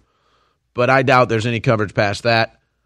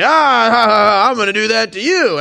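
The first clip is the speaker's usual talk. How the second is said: high, loud taunting laugh; hammed-up gloat, much higher and louder; mocking gloating villain; 10 semitones higher, 7 dB louder than usual. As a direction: cackle like a smug tormentor. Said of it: "They say".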